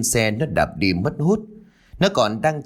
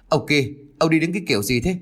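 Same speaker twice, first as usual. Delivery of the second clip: put-on fast, higher snap at the old man; snapping gruffly; 5 semitones higher, faster than usual. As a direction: bite his head off, quick and sharp. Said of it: "Đối phương kia gắt gỏng". (gắt gỏng, nói nhanh và cao giọng)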